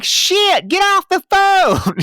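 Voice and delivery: very high mock wail, drawn out; hammed-up high-pitched wailed cursing; Gretchen impression, wailing. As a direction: imitate a frantic swearer in a high voice. Imitating Gretchen swearing on the phone.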